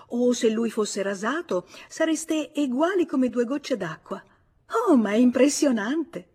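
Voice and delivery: put-on animated girl insisting on what she sees; excited exclamation. A narrator's reading. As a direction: exclaim at a striking discovery, pitch leaping up. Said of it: "Suzanne esclamò".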